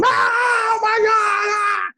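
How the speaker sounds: high, loud, slow terrified scream. Retold or imitated. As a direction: scream in pure terror. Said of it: "And we scream".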